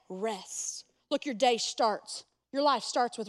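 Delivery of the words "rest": a hushed, gentle "Rest."; soft command. Shown as a demonstration. The Son of God telling the weary to rest, quietly. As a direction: let "Rest." fall softly, like a settling word. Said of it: "the Creator of the universe said".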